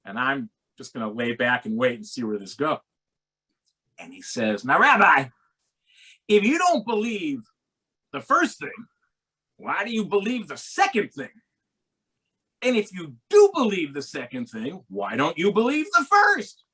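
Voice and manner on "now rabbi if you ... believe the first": corner the rabbi with his logic; challenging cab driver. Retold or imitated. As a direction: cabbie pressing the rabbi on his beliefs, high and insistent. Driver challenges rabbi, voiced high.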